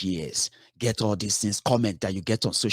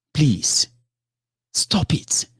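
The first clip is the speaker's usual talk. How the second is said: sudden breathy whisper; whispered plea; 5 semitones higher, 4 dB louder, much slower than usual. Real, not acted. Speaker begging followers to stop, in a whisper. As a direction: drop to a whisper and beg them to stop.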